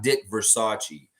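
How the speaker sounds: Versace as ver-SAH-chee, like the clothing company; wrong pronunciation. Shown as a demonstration. Host rejecting the clothing company version, mispronounced. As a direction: end Versace in a chee sound. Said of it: "It's not, it's not".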